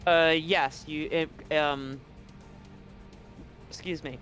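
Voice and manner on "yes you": normal talking voice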